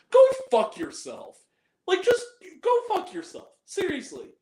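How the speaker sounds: high, exasperated telling-off; indignant, pitch jumping up; real angry outburst. Real, not acted. Angry retort to the quoted moviegoer.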